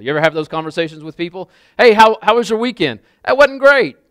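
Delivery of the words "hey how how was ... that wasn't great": acted exchange. Acted small-talk exchange.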